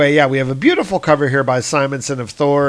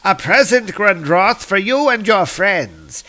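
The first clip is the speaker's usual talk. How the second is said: higher, mock-generous offering; sly Loki; 6 semitones higher than usual. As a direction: hand over the gift with a smirk. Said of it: "And Loki's like".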